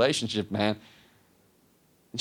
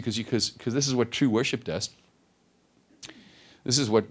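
ordinary delivery

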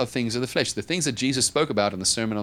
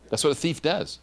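ordinary talk

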